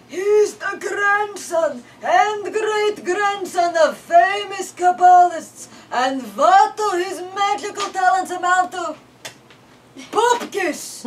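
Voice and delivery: put-on high, loud, slow scoffing old man; snorting grandpa. A narrator's reading. Grandpa ranting in story.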